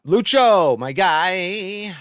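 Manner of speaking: high, loud, stretched-out shout-out; big excited hello; hyped greeting. Real, not acted. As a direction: holler to your buddy. Greeting a viewer by name.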